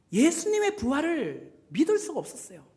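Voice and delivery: ordinary talk, nothing marked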